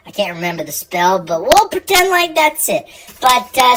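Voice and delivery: ordinary delivery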